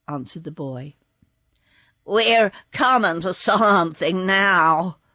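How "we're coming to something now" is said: firm horse voice announcing. Acted. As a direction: declare it like the horse. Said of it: "announced the horse".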